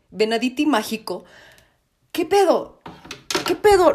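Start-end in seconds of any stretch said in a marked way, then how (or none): 2.1-2.8 shocked. Real, not acted. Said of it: "estoy en shock". 3.5-3.9 louder shock. Real, not acted. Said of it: "estoy en shock".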